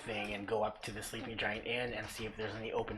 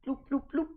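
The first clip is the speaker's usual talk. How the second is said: light, high little bloops; bouncy cartoon hop sounds; imitated hopping noises; 13 semitones higher, 5 dB louder, faster than usual. Storyteller showing the rogue climbing onto the building, playful bloops.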